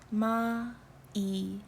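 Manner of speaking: Michael in very slow, separate Korean syllables; split into syllables. Shown as a demonstration. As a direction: say Michael as ma-i-keul, block by block. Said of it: "syllable blocks, as we can see".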